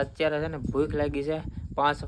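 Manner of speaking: ordinary talk, nothing marked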